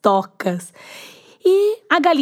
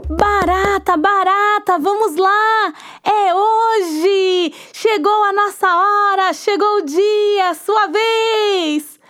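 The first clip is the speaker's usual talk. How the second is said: high, bright call to a friend; cheerful hen calling; 9 semitones higher, slower than usual. Acted. Hen knocks and calls the cockroach to work.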